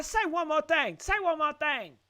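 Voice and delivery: high, loud taunting threat; retold dare. Host retells the man daring the woman to speak again, loud and threatening. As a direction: spit out the dare like he wants a fight.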